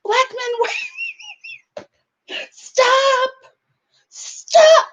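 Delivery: high, drawn-out mocking wail; mocking Sarah. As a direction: stretch it out like a swooning damsel. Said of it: "That's what she".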